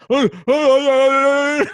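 very high, loud panicked stammer; hammed-up yelps of a man caught out; flustered mock shout. Dad reacts to question about Mitchell, hammed-up panicked shouting.

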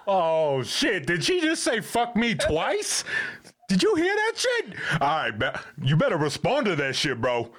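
squealing high, incredulous board voice; hammed-up, high-pitched disbelief, with a laugh underneath; mock shocked board. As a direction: act out the board's outrage in falsetto. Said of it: "the board was like".

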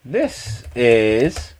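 slow, halting, distracted delivery; dictating while typing. Real, not acted. Host typing a message to someone, dictating haltingly. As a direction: say it piecemeal as you type each word. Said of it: "He is dictating what he is spe".

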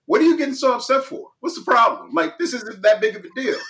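mock-casual wife shrugging off his anger; mimicking wife. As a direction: brush it off as the calm wife. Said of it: "And she's like".

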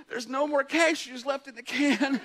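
high, slow, hammed-up sobbing; imitating a sobbing wife, pitched high; mock sobbing voice. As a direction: wail it slowly like a tearful wife, for laughs. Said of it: "in her sobbing voice".

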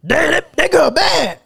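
lightly voiced smitten guy; a hint of a smitten churchgoer; lusting churchgoer.

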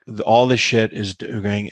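ordinary delivery

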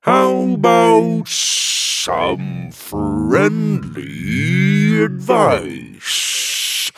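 huge, very slow drawn-out giant voice; sheepish giant. A narrator's reading. Giant asking the heroes for advice, slow and sheepish. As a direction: stretch every word out like a lumbering giant. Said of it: "asked one of the giants sheepishly".

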